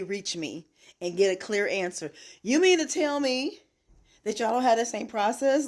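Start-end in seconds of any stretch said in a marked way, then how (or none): 2.4-5.7 indignant. Real, not acted. Ranting at LifeLock.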